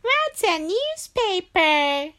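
put-on high, slow puzzled kid. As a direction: ask like a clueless kid.

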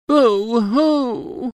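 high mock baby boo-hoo; baby crying. A narrator's reading. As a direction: wail like a little baby. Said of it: "A baby cries".

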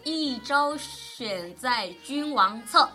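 slow, drawn-out classical verse; poem recitation. Shown as a demonstration. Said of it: "后面一句话是啥？".

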